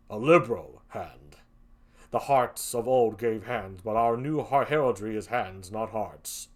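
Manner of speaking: low, slow suspicious Othello. Acted. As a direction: Othello probing his wife, low and pointed. Othello voiced in play reading.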